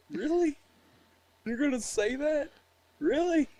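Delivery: high, amused disbelief; incredulous. Retold or imitated. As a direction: ask it with laughing disbelief. Laughing disbelief at a buddy.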